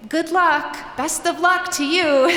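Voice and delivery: awkward farewell. Retold or imitated. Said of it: "backing away like".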